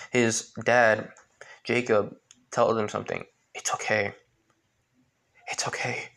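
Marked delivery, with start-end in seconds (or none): 3.5-6.1 soothing, fading to a whisper; calm him softly, then breathe it; dying father whispering comfort; soft reassurance. Acted.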